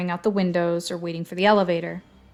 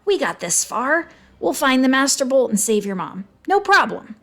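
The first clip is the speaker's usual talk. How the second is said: high-pitched sidekick, trying to be brave; high, eager reassurance; Grover; 5 semitones higher, 4 dB louder than usual. A narrator's reading. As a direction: put on cheer in a high character voice. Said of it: "he chimed in".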